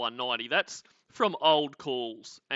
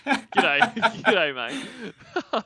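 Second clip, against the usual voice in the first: higher, louder, breaking into laughter; laughing greeting; 8 semitones higher, 7 dB louder, much slower than usual. Real, not acted. Host greeting viewers by name, cracking up. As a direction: greet them through real laughter.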